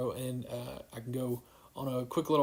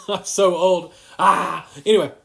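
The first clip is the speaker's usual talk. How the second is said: loud, higher, laughing self-mockery; 3 semitones higher, 9 dB louder than usual. Real, not acted. Husband laughing at himself over learning Zoom, loud laughing delivery. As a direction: laugh loudly at yourself, ending in a cry.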